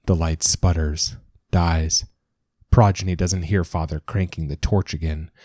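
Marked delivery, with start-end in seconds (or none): none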